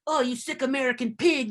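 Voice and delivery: mock-angry Italian commenter in a muddled French-ish accent; badly faked French accent, hammed up a little; botched French accent. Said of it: "what that accent was. That was French".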